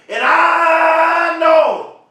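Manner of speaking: cries out, voice cracking, drawn out very slowly; real tears breaking into a shout. Real, not acted. Speaker to hurting viewers, crying out with breaking voice. Emotional peak of motivational speech.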